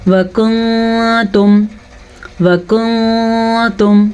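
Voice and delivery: وکنتم with the noon hidden in a long nasal hum; very slow recited وکنتم, noon concealed; ikhfa. Shown as a demonstration. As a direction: hold a nasal hum before "tum" in وکنتم. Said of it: "تو کیسے؟".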